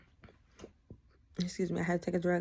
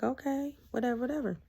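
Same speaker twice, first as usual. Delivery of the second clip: high-pitched dismissive mimicry; arrogant; 6 semitones higher than usual. Ex answering her goodbye text, mock arrogant. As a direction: brush it off, imitating an arrogant man. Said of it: "'cause he's so arrogant".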